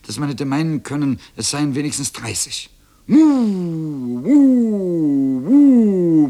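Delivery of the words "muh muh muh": loud, drawn-out high moo; bellowing cow. A narrator's reading. Cow Mairos greeting the boy, bellowing. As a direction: bellow long falling moos. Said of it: "brüllte Mairos".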